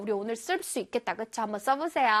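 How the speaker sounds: normal talking voice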